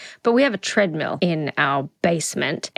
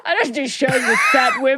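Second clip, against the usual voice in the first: hammed-up tough-guy parody shouted fast; mock Andrew Tate; 7 semitones higher, faster than usual. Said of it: "good one, Andrew Tate".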